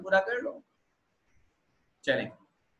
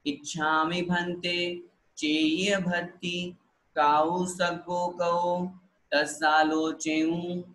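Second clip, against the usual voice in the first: slow, careful chanted recitation; slow, clear devotional chant; much slower than usual. Shown as a demonstration. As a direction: give every syllable its time. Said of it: "इसी लय में और ऐसे ही धीरे धीरे बोलना है।".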